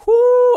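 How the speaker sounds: high, loud whoop of nerves; excited whoop. Real, not acted. Streamer whooping to viewers as the fight begins, loud and high. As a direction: let out a big nervous whoo.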